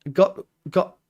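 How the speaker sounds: ordinary talk, nothing marked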